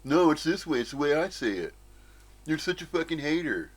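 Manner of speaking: mildly mock someone full of themselves; self-important know-it-all. Mimicking ignorant people who insist on their way, lightly self-important. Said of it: "Very full of themselves".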